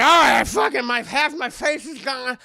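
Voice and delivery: loud rough doll villain growl; mock raspy Chucky. Chucky with half his face gone, loud villain snarl. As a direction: snarl it like a wounded killer doll.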